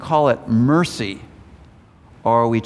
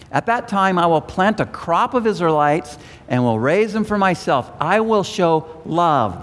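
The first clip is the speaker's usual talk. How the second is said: higher, declaimed Bible verse; scripture reading; 6 semitones higher than usual. A narrator's reading. Preacher reading God's promise to congregation, declaimed scripture reading. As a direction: read the prophecy out with lifted pitch.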